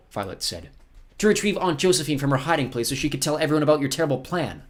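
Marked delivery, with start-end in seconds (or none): 1.2-4.6 put-on high, forceful girl's voice; defiant girl. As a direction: accuse the villain, pitched high.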